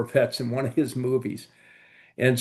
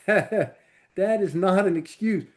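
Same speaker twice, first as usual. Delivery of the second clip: higher-pitched imitation of a Southern principal; mock the old Southern-accented principal, pitched up; Mr. Potes Southern accent; 9 semitones higher than usual. Principal scolding a late student, imitated in a higher Southern accent. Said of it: "He goes".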